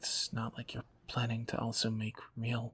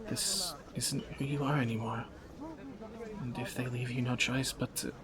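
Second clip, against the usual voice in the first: ordinary talk, nothing marked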